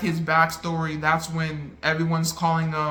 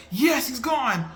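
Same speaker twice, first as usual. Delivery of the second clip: higher, slow relieved cheer; hopeful cheer; 7 semitones higher, slower than usual. Retold or imitated. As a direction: cheer as the villain seems gone. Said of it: "I'm going back and forth".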